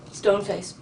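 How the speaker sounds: normal talking voice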